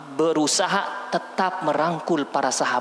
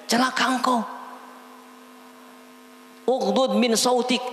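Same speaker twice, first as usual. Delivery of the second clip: raised, stern scolding; stern rebuke; 4 semitones higher than usual. Retold or imitated. Sofwan rebuking the Bedouin, raised stern scolding. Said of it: "Sofwan tadi berkata".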